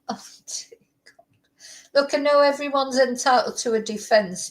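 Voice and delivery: ordinary talk, nothing marked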